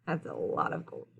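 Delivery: soft, low whisper to the listeners; whispered aside. Real, not acted. Reader marvelling with children at the gold, whispered aside. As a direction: whisper it as a side comment.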